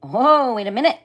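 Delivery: loud, high startled "wait"; alarmed plea. A narrator's reading. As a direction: stop him in sudden alarm. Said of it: "said my father".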